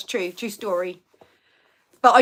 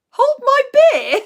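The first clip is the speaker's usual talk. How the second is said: much higher and louder joke voice; mock bravado; 14 semitones higher, 8 dB louder than usual. Guessing the clue for viewers, in a hammed-up voice. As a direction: toss off the line like a cocky mate.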